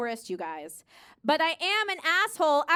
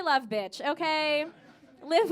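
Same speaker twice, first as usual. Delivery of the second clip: ordinary talk, nothing marked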